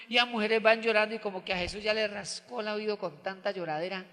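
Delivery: usual speaking voice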